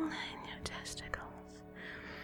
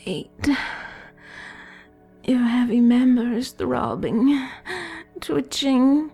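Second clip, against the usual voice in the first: louder and higher, rising to a peak; building excitement; 4 semitones higher, 6 dB louder than usual. Acted. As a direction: push the energy up to climax. Countdown builds to climax.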